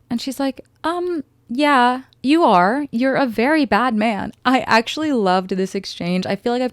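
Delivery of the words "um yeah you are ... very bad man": sassy retort. Retold or imitated. Elphaba retorts to the wizard.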